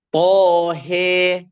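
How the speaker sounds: "Tohe" drawn out, last a tilted to "e"; imalah. Shown as a demonstration. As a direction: recite "Tohe" long, with heavy "to" and long "he". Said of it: "huruf ha dibaca imalah".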